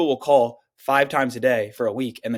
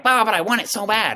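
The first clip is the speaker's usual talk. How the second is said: high, pleading whine; mock whiny wannabe, voice high; mock whiny plea; 9 semitones higher, 4 dB louder than usual. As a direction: imitate a needy beginner, pitched up. Mocking whiny mentee.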